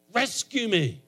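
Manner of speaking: high, loud cry for rescue. Acted. Praying man cries out to God for rescue, high and loud. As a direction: call out urgently to God. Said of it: "He cried out to God. It's like".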